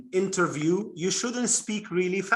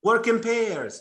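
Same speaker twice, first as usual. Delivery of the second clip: classroom instruction; 5 semitones higher, 3 dB louder, slower than usual. Shown as a demonstration. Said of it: "So you say".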